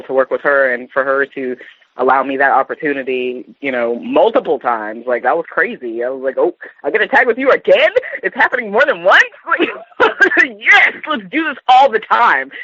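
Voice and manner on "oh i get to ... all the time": high, giddy retold delight breaking into laughs; thrilled squeal. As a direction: gush like a fan meeting her idol, laughing. Excitedly retold reaction to good news.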